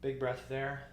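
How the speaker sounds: ordinary delivery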